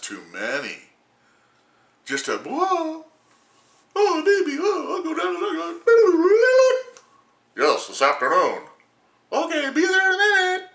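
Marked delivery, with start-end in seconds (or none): 2.4-10.7 high, loud mocking falsetto. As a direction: mimic the eager doctor up high. Mocked doctor on the phone.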